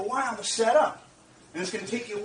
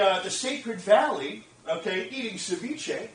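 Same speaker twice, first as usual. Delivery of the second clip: normal talking voice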